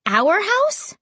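surprised. A narrator's reading. Surprised at Ray's news.

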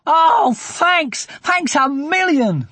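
high, loud, thrilled thanks; excited shout. Acted. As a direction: shout your thanks with delight. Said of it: "they shouted".

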